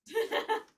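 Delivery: loud high burst of laughter; high, loud cackling laugh. Real, not acted. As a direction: crack up at your own joke. Laughs at own joke.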